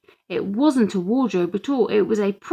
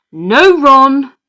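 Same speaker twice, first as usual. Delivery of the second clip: sharp shout, much louder and higher; 6 semitones higher, 14 dB louder than usual. A narrator's reading. Mum cutting off Dad's joke with a shout. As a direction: cut him off with a loud no. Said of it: "shouted Mum".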